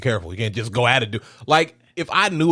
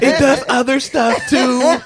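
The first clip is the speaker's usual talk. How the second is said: mock girly teen voice; 12 semitones higher, 5 dB louder, slower than usual. Echoing the mocked girl voice.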